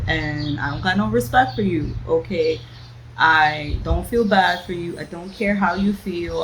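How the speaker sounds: usual speaking voice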